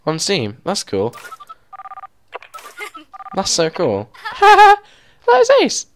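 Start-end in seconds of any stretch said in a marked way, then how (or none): 4.2-5.8 high, loud words through laughter; delighted laughing. Real, not acted. Player seeing a friend visit the park, real delighted laughter. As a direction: crack up while talking, pitch shooting up.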